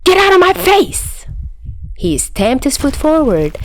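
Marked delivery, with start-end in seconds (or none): 0.0-1.1 high, loud furious shout; angry shout. A narrator's reading. Chuya shouting at Dazai to back off, furious shout. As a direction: yell it right in his face.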